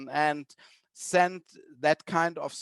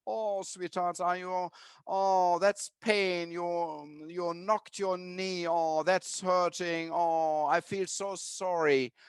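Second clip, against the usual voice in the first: tender comforting; slower than usual. Retold or imitated. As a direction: comfort a child who knocked her knee, gently. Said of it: "She says".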